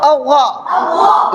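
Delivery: अव्वा thick and rounded, slowly stretched; मोटी आवाज. Shown as a demonstration. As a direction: draw out a full-mouthed, heavy अव्वा. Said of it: "मोटी आवाज में". (अव्वा को मोटा, लंबा खींचकर)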